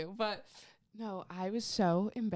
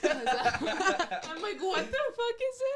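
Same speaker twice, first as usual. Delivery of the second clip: much higher, laughing mock horror; laughing disbelief; 10 semitones higher, 5 dB louder than usual. Retelling the class watching the clip, laughing disbelief. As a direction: squeal it out through laughter. Mocking horrified reaction, laughing.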